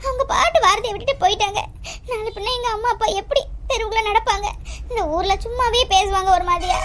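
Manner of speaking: tearful, sobbing between phrases; crying. Acted. Bride's sister-in-law fearing village gossip for her parents, crying. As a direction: plead and lament through sobs. Weeping over the family's shame.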